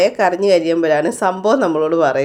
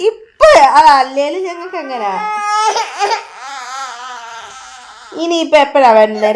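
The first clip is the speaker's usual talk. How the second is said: crying; 5 semitones higher than usual. Real, not acted. Upset over the last sweet, crying while asking. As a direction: sob the words out, upset and pleading.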